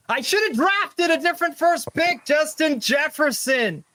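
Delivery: high-pitched, strained regretful wail; mock lament. Host bemoans his first draft pick, high mock wail. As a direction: wail it high, drawing out the name.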